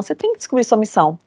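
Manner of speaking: ordinary talk, nothing marked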